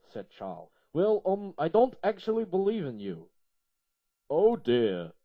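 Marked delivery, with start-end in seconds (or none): 0.9-3.3 higher and louder, hesitant character voice; a higher, hesitant put-on voice; Charles's voice. Charles tells God doubt. 4.3-5.1 put-on Yorkshire accent. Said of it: "thick Yorkshire accent".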